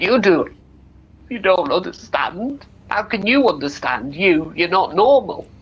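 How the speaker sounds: high, loud acted upset from an aged mother; hurt mother lashing out through acted tears; tearful outburst. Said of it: "She starts crying again".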